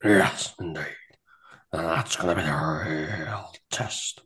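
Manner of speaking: ominous whisper. Acted. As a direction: hush it like a foreboding omen. Commentator contemplating showdown.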